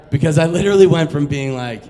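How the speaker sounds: ordinary delivery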